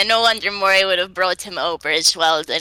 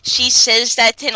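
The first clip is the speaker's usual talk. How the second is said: normal talking voice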